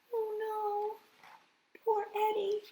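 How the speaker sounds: high soft mournful cry; soft high grieving; sad lament. Acted. Al mourns lost friend.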